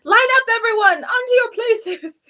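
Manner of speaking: high, loud barked stage orders; imagined self bossing a talent show cast, high and loud; bossy director. Retold or imitated. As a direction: order the troupe into place like a stage manager.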